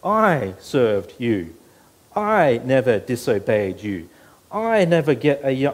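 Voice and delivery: "I" hammered loud and slow at each start; loud slow emphasis on "I" in "I served you"; stress on I. Shown as a demonstration. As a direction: lean hard on every "I". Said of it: "how many times he says the word "I".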